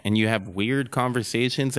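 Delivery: normal talking voice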